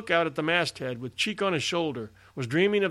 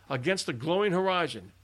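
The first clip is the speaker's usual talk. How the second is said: plain reading voice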